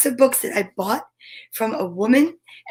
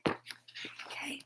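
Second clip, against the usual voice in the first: breathy whispered okay; 23 dB quieter than usual. Real, not acted. Reader whispering a quick okay. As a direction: barely breathe it.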